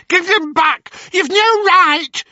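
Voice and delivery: high, loud frantic crone cries; panicking hags. Acted. Sisters panicking over the stolen tooth, high frantic crone voices. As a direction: wail like witches robbed.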